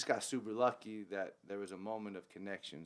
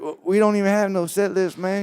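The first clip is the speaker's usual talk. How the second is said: excited band mate; 10 semitones higher, 17 dB louder than usual. Retold or imitated. Keys player laughs about no key.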